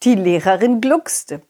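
plain reading voice

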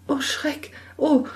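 put-on high, gasping girl recoiling in fright; young girl crying out in fear; frightened girl cry. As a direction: shrink back in alarm. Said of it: "rief das Mädchen".

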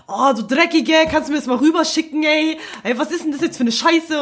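höher, derb und frech; asozial reden. Retold or imitated. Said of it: "ich red asozial". (Kollegin asozial anpflaumen, höher und lauter)

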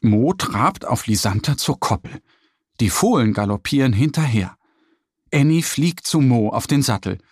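ordinary delivery, nothing marked

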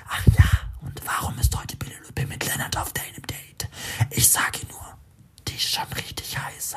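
cold, cynical whisper. A narrator's reading. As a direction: whisper it like a mocking inner voice. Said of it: "spricht die Stimme zynisch".

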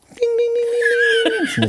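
high ding ding ding, slow like a knife on a wineglass; mock glass chime; glass clinking imitation. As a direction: tap a glass to call attention.

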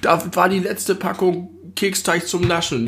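langsam und höher, wie ein alter Mann; erzähl es wie ein Opa vom Krieg; Opa erzählt vom Krieg. Pretend. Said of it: "wir so Opas". (alter Opa, langsam und etwas höher)

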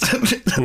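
short high burst of laughter. Real, not acted. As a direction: laugh at the absurdity. Laughing at own reaction.

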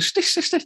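squeaky high-pitched „Stich“ jabs; high stabbing sound. Pretend. Venting friend miming little stabs of annoyance, squeaky high jabs. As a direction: jab out each „Stich“ in a piercing high voice like tiny needles. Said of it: "Und dann kommt eine andere Sache".

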